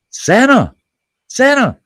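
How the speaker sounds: shouted high calls of Santa; calling out. Retold or imitated. Neighbor calling out to Santa from the sidewalk, shouting. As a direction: yell for Santa from the street. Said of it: "and I hear".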